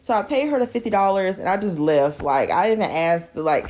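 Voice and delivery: ordinary talk, nothing marked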